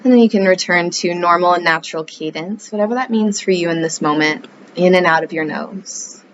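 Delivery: calm yoga-teacher voice; guided meditation. Acted. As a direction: ease the listener into the breath, unhurried.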